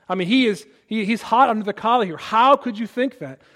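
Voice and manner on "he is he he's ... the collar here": ordinary talk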